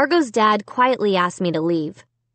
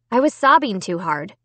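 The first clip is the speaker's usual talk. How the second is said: plain reading voice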